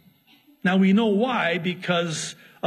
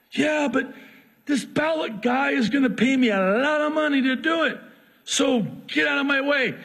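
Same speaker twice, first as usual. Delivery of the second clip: mild greedy brush-off; 9 semitones higher than usual. Acted. Balaam brushing off donkey.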